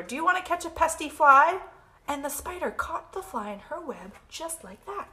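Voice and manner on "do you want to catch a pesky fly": loud, high rooster character; raised, forceful bird question; storybook rooster. Acted. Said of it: "crowed the rooster".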